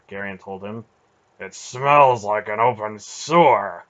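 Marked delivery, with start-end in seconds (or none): 1.4-3.8 loud, slow disgusted grumble; complaining. A narrator's reading. Beric complaining about the stench, loud and disgusted. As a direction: wrinkle your nose and grumble. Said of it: "Beric complained".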